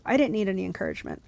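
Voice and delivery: plain reading voice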